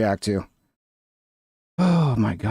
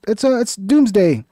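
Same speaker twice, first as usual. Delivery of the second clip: announcing Doomsday Tuesday; 6 semitones higher, 4 dB louder, slower than usual. Real, not acted. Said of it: "It's Doomsday Tuesday".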